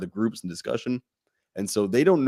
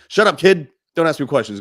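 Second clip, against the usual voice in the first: put-on loud, high snap at the kid; imitating an angry parent; 5 semitones higher, 7 dB louder than usual. Fed-up father shouting down his questioning kid, loud and high. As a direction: bark it like a fed-up dad.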